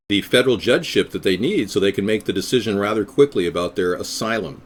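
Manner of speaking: ordinary delivery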